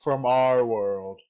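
movie trailer narrator. Acted. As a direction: intone it like a film trailer.

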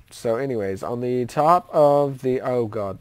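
usual speaking voice

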